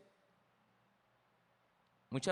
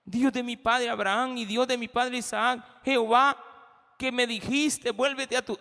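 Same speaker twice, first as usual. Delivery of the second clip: a raised, earnest prayer voice; Jacob praying; 5 semitones higher, 6 dB louder than usual. Acted. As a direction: speak as Jacob reminding God. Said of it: "Dios te había dicho".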